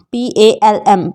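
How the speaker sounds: ordinary talk, nothing marked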